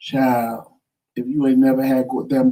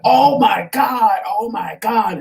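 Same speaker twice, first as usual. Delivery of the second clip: high, drawn-out cry, loud at first; dramatized agonized exclamation; retold pained screaming; 7 semitones higher, 3 dB louder, slower than usual. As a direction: re-enact waking up crying out in pain. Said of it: "I'm screaming and thriving in pain".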